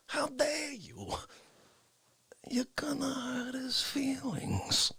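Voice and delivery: high, slow, hushed and wounded parody; Dick Fauci. Retold or imitated. Fauci defending an invisible person's feelings, Fauci impression. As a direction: drag it out softly, deeply affronted. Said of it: "this is what Fauci did".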